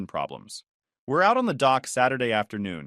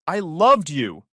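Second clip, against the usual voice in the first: grand revelation; 5 semitones higher, 7 dB louder, slower than usual. A narrator's reading. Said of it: "like this is some grand revelation".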